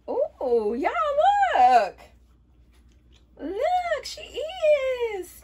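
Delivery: loud, high, drawn-out squeal of discovery; excited amazement. Real, not acted. Showing viewers the cap fits the wig, excited amazement. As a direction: squeal it to your viewers in delight.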